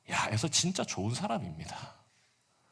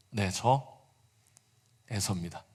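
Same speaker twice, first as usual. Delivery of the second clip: lightly lowered, quiet voice; a hint of a put-on low voice; faked deep voice; 5 semitones lower, 4 dB quieter than usual. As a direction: Jacob answering again in his brother's deep voice. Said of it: "낮은 목소리를 흉내 냈는데".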